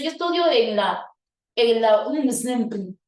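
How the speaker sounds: "unsnp" pronunciado como una sola palabra; leído de corrido. Shown as a demonstration. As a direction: di "unsnp" de corrido, sin deletrear. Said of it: "Así lo pronuncias". ("en la unsnp" leído como palabra, sin separar letras)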